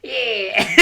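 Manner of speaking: rowdy Cockney orc. Acted. Orc eager to blow things up, rowdy mock Cockney cheer. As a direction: play an orc itching for a scrap. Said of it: "the orcs' perspective, where, like".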